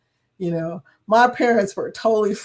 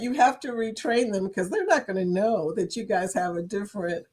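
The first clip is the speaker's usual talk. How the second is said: ordinary talk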